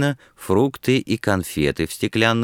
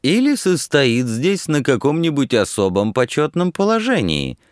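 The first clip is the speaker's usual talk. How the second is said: higher-pitched needling with emphasis; pointed, barbed; 7 semitones higher than usual. A narrator's reading. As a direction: put in a barb, stressing the words. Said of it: "колко с подчёркиванием вставил Борис Собашников".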